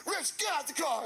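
high-pitched, urgent command; acted order. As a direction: imitate an irate driver ordering someone out. Retold order from irate driver.

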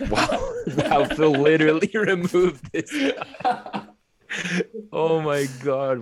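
high, laughing disbelief; laughing wow. Real, not acted. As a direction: crack up in disbelief. Laughing at Phil leaving.

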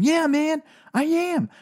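high, exalted epiphany voice. Retold or imitated. Guy announcing to his friend he is like Jesus, exalted and high. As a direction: proclaim it like a revelation. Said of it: "this guy's going".

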